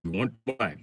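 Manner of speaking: usual speaking voice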